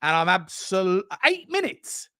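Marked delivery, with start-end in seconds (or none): 1.2-2.1 disbelief. Real, not acted. Realising how long he was silent.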